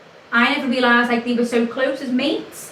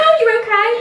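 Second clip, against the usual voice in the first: much higher and louder, nervous squeak; retelling her panicked greeting, pitched way up; 11 semitones higher, 6 dB louder, faster than usual. Retold or imitated. Greeting the ex's girlfriend, nervous high squeak. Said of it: "my voice went, like, five times higher".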